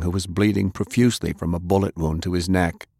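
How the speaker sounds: usual speaking voice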